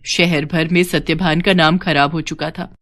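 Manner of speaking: ordinary delivery, nothing marked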